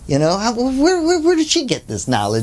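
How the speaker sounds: high, searching bewilderment; baffled disbelief. Retold or imitated. Ram Dass wondering where she got this knowledge, high and baffled. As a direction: sputter it out, truly puzzled.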